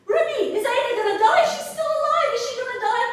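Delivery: very high, loud, sing-song plea; the groom asking Ruby if his bride will die, high and pleading; desperate groom. Acted. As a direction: wail the question up high.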